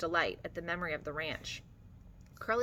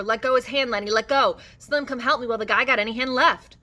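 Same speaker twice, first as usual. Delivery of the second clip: loud, higher, urgent commands; shouting; 5 semitones higher, 10 dB louder than usual. A narrator's reading. George shouting for Lenny to release Curly, loud urgency. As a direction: keep shouting, desperate to stop him. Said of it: "George shouted over and over".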